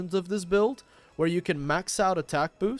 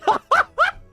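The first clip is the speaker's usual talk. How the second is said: loud high-pitched burst of laughter; 9 dB louder than usual. Real, not acted. Laughing at chat.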